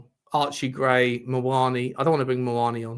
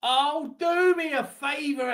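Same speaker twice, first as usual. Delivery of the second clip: exasperated disbelief; 13 semitones higher than usual. Real, not acted. Disbelief at foul call.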